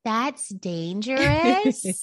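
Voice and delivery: put-on slow, drawn-out little-boy warning; imitating Dallas. Father imitating his son's serious little warning. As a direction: mimic the toddler saying it seriously. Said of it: "I can hear Dallas now".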